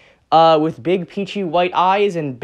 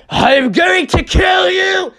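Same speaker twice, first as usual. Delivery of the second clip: slow, higher, louder roared threat; villain threat shout; 12 semitones higher, 4 dB louder, much slower than usual. Acted. Noir Rabbit threatening his minion, in a slow roaring shout. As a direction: bellow it like an enraged villain.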